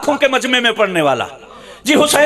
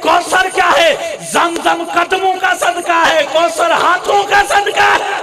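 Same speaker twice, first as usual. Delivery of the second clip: rising preaching; 4 semitones higher, slower than usual. Acted. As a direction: build the question and answer to a ringing peak. Preaching crescendo.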